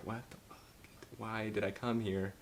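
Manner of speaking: soft incredulous aside; baffled aside. Retold or imitated. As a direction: mutter it in disbelief. Woman baffled by breakdown.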